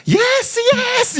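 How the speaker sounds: very high squealed taunt; mock gleeful laughing. Prankster answering the angry accusation, high mock-gleeful squeal. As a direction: squeal it out, drawn out. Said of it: "막 이렇게 웃는 거야".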